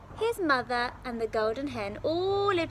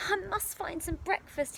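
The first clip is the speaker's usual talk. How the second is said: put-on boy thinking aloud; 8 semitones higher than usual. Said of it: "he thought".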